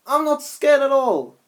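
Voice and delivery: high, loud defiant insisting; insistent. Acted. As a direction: insist you're not afraid. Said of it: "Snaptrap insisted".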